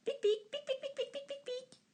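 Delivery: pics très aigus qui s'éteignent. A narrator's reading. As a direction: piaillements d'écureuil, très haut et de plus en plus doux. Écureuil imité dans la réplique. (pics d'écureuil très aigus)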